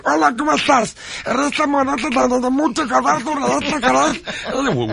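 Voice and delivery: high, raspy goblin Spanish. Retold or imitated. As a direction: croak it like a little goblin. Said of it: "he sounds like a goblin".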